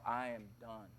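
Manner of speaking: very low, hushed resignation; doomed resignation. Retold or imitated. Boy sent in for his own spanking, quietly doomed. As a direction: drop low and quiet, sunk in dread.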